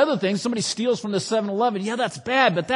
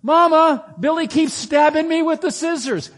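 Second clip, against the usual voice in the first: put-on high, loud whiny kid calling for mom; a little kid tattling from the next room; high pitched child complaining to Mama; 8 semitones higher, 6 dB louder than usual. You hear the voice again.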